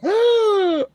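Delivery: high sharp shocked gasp; a loud high collective gasp; nymphs gasping. Retold or imitated. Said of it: "all the nymphs go".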